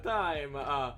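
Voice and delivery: mock Italian drawl stretched out with uh fillers; obnoxious Italian accent. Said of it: "doing as obnoxious an Italian accent as I".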